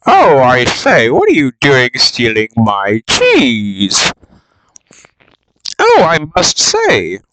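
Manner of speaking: slow, prim, indignant English gent; fussy upper-class huff; posh English voice. Retold or imitated. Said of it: "he's like".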